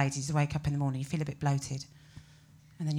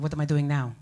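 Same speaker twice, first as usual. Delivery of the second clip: put-on Spanish accent; 4 semitones lower, 5 dB louder than usual. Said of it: "a very strong accent like this".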